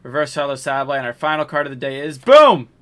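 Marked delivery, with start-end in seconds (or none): 2.2-2.7 loud punchy boom; big hype reveal; excited shout. Real, not acted. Dramatic card reveal.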